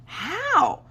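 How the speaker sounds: high-pitched puzzled squeak; mock bafflement. Acted doubting aside about paternity.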